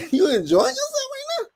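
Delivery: squeaky high mock question, teasing; mocking falsetto. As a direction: tease him in a tiny high voice. Mocking the dancing man.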